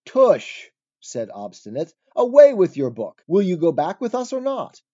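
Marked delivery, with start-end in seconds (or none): 0.1-0.7 loud, high burst of impatience; scornful dismissal. A narrator's reading. Obstinate scornfully rejects the book. 2.1-4.8 loud, raised-pitch ultimatum; impatient demand. A narrator's reading. Obstinate angrily demands they return.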